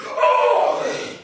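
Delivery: a loud rough troll call; terrible troll shout. Acted. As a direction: bark it like a monster. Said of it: "he heard the most terrible voice".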